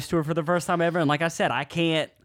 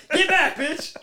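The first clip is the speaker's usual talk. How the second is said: high, hammed-up warding-off cry; mock alarm; 13 semitones higher, slower than usual. Grandson wards off Dracula grandmother, mock alarm. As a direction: fend off a vampire in play.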